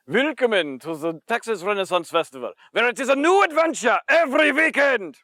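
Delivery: German-accented welcome, building high and loud; emphatic German accent, rising to a loud finish. Shown as a demonstration. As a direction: greet in a German accent, ending on a high shout. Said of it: "this German accent is short and clipped".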